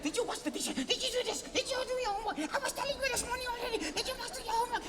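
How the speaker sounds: high, fast nagging questions; nagging parent. Retold or imitated. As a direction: rattle off chores in a mock scold.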